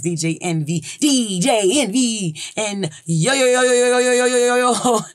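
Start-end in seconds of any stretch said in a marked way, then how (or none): 1.0-2.4 stretched-out, higher hype call; show-intro call. Retold or imitated. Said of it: "at the top of the Breakfast Club". 2.5-5.0 high, loud, sustained string of hype shouts; Charlamagne tha God. Retold or imitated. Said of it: "he do that shit".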